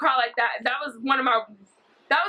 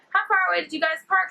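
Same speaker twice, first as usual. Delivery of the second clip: higher, quick imitation of the woman; fake-friendly stranger; 6 semitones higher, faster than usual. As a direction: ask it fast and high, lightly mimicking. Acting the woman's question again.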